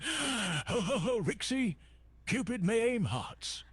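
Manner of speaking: booming high ho ho ho; jolly, excited Santa greeting; jolly Santa laugh. Acted.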